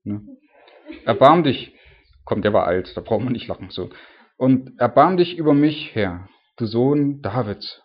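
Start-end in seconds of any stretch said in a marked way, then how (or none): 1.1-1.7 loud acted plea; crying out for mercy. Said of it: "die rief ihn an". 4.4-7.8 slow, loud plea that eases off; pleading call. Acted. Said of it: "die rief ihn an".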